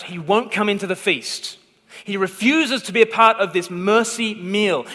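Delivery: usual speaking voice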